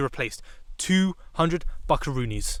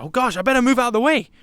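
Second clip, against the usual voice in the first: higher, louder, mildly panicked self-quote; mock alarm; 7 semitones higher, 5 dB louder, faster than usual. Driver to himself as police appear behind, mock alarm. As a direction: act out a flustered realisation. Acting out reaction to police lights.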